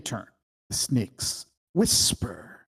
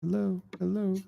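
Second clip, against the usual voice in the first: a distant, softer echo of the call; 7 semitones higher, 5 dB quieter than usual. Acted. Josie's voice echoing back from the pit, faint and far. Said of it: "Josie's voice echoes".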